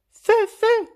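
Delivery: alarme criée, très aiguë et très forte; crié. A narrator's reading. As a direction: hurle l'alerte au feu, paniqué. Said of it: "cria Phalange volant". (cri d'alerte perçant)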